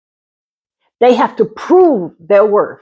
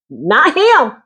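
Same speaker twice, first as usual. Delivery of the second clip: ordinary talk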